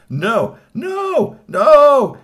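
high, loud, mounting refusals; hammed-up furious boss; angry yelling. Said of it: "He started yelling".

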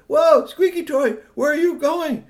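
high, loud call after someone leaving; alarmed shout. A narrator's reading. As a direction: holler it up into the air, high and drawn out. Boys call after rising toy.

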